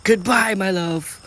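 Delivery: high, loud lovelorn call; yelling inner voice. A narrator's reading. Inner voice to departing crush, lovestruck yell. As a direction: holler a swooning farewell. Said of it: "inner voice continues yelling this time".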